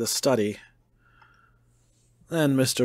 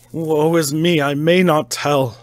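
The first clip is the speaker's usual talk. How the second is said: loud, anguished wail; anguished cry; 3 semitones higher, 7 dB louder than usual. Acted. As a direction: cry it out in despair. Madman pleading with doctor.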